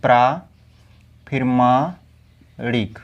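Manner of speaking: प्रा, मा, णिक spelled out slowly and low; syllable by syllable. Shown as a demonstration. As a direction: break प्रामाणिक into slow syllables. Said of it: "ये क्या हो जाएगा आपका?".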